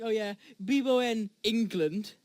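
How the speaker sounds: mock Spanish speaker, slow; a hint of a Spanish tourist; Spanish accent. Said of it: "you don't see Spanish people going".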